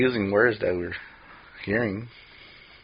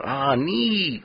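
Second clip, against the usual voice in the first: higher-pitched mocking imitation; making fun; 4 semitones higher, 4 dB quieter than usual. Mimicking people who used the slang, mocking. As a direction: poke fun at how people say it. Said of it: "I'm making fun of it though".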